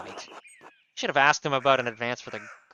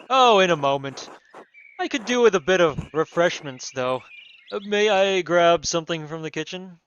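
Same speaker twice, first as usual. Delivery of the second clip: loud, booming noble voice; baron voice; 2 semitones higher, 9 dB louder than usual. Acted. Baron barging into the abbey, booming and pompous. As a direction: announce yourself like a pompous lord. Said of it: "these fucking wild accents".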